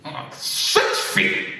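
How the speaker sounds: put-on slow, grunted warning from a cranky old man; imitated old man's curt grunt; gruff grunt. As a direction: grunt it out like a grumpy neighbor. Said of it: "looks up at me and grunts".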